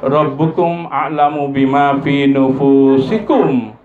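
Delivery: drawn-out intoned Arabic verse; chanted verse, slow; slow Quran recitation. Acted. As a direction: recite it slowly and reverently. Recites Quran verse again slowly.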